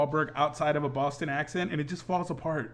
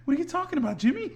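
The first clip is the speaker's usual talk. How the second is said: mock movie line; 12 semitones higher, faster than usual. Joking impression of movie line.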